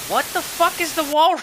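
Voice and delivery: loud, high, disbelieving shout; shocked yell. Real, not acted. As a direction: blurt it out in disbelief. Shocked outburst at the wall.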